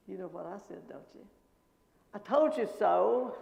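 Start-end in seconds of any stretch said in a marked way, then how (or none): 2.1-3.4 loud, slow, gloating I-told-you-so; loud slow triumphant shout. Retold or imitated. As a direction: crow it out loud. Triumphant retort to director.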